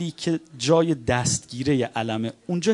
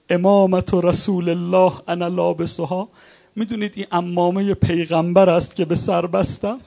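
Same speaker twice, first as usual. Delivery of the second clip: louder, higher mourning sermon voice, rising to cries; full-force preaching; 3 semitones higher, 3 dB louder than usual. Acted. Preacher voicing the imam questioning the enemy army, at full force. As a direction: preach the Karbala dialogue at full force, pleading. Rowzeh narration of Karbala dialogue.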